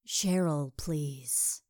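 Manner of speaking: overly breathy come-on; breathy, falling, would-be sultry murmur; seductive and breathy. A narrator's reading. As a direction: try too hard to sound seductive. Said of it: "I tried to sound seductive and breathy".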